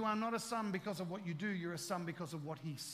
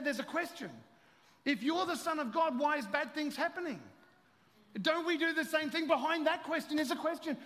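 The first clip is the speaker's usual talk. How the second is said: higher, louder preaching; fervent preaching; 10 semitones higher, 5 dB louder than usual. Acted. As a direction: drive the message home. Preaching at full force.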